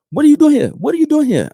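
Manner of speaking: very high, loud mock outrage. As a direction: imitate an alarmed landlord confronting an intruder.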